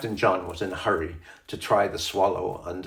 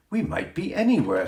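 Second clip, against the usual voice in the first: put-on a little girl's raised, dreamy wonder; lightly voiced child marveling; 9 semitones higher than usual. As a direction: say it as if the tent became a ship. Titty imagining in tent.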